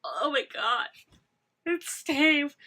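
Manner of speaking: high, loud thrilled outburst; excited gasp. Real, not acted. Fan reliving Steve's entrance, loud thrilled outburst. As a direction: burst out as if he just walked in.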